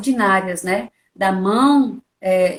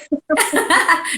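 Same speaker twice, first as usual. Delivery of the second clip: real laugh; 6 semitones higher than usual. Real, not acted. Laughs at own question.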